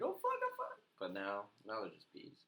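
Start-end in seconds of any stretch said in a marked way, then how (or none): 0.0-0.8 high-pitched panicky retelling; mock scared. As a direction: act out a bee scare in a high voice. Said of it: "so scared of bees".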